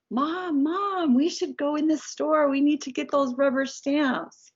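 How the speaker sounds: high, insistent imitation of a small child; pleading little boy. Little son tugging on mom's sleeve at a store, pleading child voice. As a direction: plead high, like a kid after Mom's attention.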